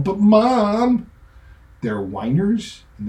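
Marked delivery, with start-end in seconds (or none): 0.0-1.1 put-on much higher, louder childish whine; whining kid.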